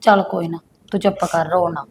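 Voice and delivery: quiet comfort for a crying niece; soft consoling. Acted. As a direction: hush her tears gently. Aunt consoles crying wife.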